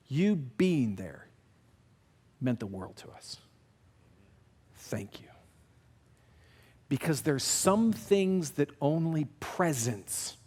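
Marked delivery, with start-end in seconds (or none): 0.1-5.3 slow, hushed sincerity with long pauses; dead serious. Retold or imitated. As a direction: drop low and soft, letting each phrase land. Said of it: "and he's dead serious".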